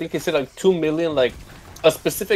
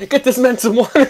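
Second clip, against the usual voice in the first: mock urgent call; 7 semitones higher, 3 dB louder than usual. Acting urgent call for water.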